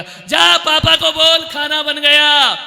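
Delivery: high, slow, drawn-out yell; loud shouting. Acted. Mother making her son the messenger to papa, yelling. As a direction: holler it out as a nagging mother. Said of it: "इतनी जोर से बोल रही है".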